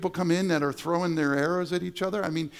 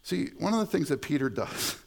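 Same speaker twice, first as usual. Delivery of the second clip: usual speaking voice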